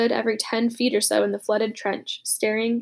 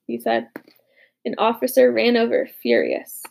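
ordinary delivery

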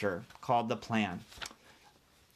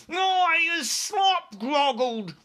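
very high, slow, loud squawk; squawking giant; 14 semitones higher, 5 dB louder, much slower than usual. Acted. Bound giant squawks at the helicopters, very high and loud. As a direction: squawk it like an outraged bird. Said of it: "squawked the gizzard gulper".

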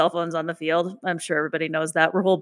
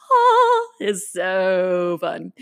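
gushing; much slower than usual. Retold or imitated. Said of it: "And I was like".

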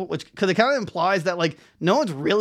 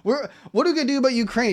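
higher, hurried mock Russian listener; fast, raised pitch, putting on someone fretting; imagined Russian listener; 8 semitones higher, faster than usual. Podcaster imagines his one Russian listener, lightly mocked worry. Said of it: "he's just sitting there like".

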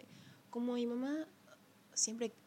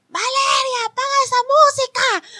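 much higher, loud shout, last words drawn out slowly; mom yelling from balcony; 16 dB louder than usual. Retold or imitated. Mom shouting from the balcony to stop the party music. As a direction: holler down from the balcony like an angry mom. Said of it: "mi mamá gritaba de mi balcón".

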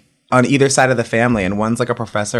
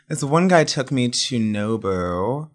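ordinary delivery

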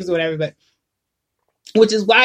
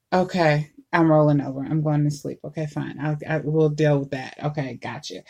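soft, drowsy mumbling, much lower and softer; sleepy mumble; 5 semitones lower, 6 dB quieter than usual. Retold or imitated. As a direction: answer while barely awake, softly. Half-asleep kid answering dad.

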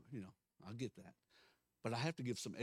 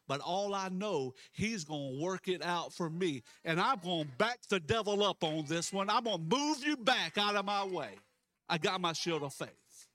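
loud, rising defiant preacher; full-force preaching; 7 semitones higher, 8 dB louder than usual. Real, not acted. Preacher warning the devil off, full-force preaching. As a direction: build it up and push back hard.

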